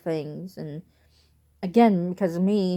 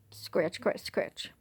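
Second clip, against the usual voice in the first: softer, fading mock scratching words; quiet scratch sound; 8 dB quieter, much slower than usual. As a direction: mouth the scratching softly. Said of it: "She would literally be like".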